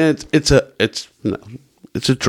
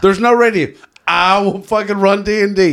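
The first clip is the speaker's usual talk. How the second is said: higher, eager and emphatic; excited; 8 semitones higher than usual. Real, not acted. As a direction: pitch it up with glee. Excited apocalypse fantasy.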